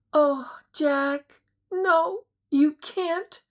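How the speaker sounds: high, slow, crying plea. A narrator's reading. Anne crying up to Jack, high and slow. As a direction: let it break into a cry. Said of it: "Her cry turned into a deep moan".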